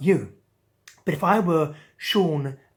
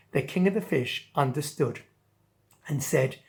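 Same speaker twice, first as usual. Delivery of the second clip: usual speaking voice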